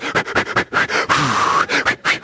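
quick puffing breaths, imitating childbirth; labor-style panting. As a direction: pant and blow as if in labor. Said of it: "sound like a woman having a baby".

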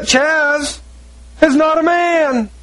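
high-pitched preacher's insistence; insistent, high exclamation; emphatic denial. Real, not acted.

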